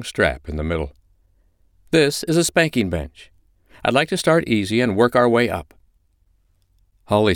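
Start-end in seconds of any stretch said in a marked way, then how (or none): 1.9-5.7 high-pitched, controlled instructing voice; composed dominant mistress. Acted. China shows Holly the bench, in command. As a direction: instruct your submissive with easy authority.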